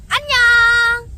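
loud, high, drawn-out bye; big cheerful goodbye. Acted. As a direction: wave off viewers brightly.

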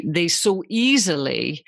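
ordinary talk

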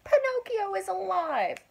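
put-on high, joyful shout of an old man. A narrator's reading. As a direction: cry it out in wonder. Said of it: "he shouted".

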